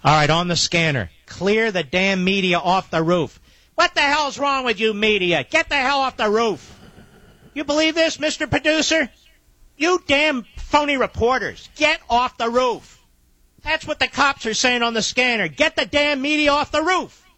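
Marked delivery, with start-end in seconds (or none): 3.8-6.8 high, outraged scolding; exasperated high-pitched rant; indignant rant. Real, not acted. Host outraged at media on roof. 7.5-13.0 high, outraged scolding; exasperated high-pitched rant; indignant rant. Real, not acted. Host outraged at reporters.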